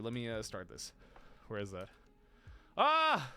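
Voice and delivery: normal talking voice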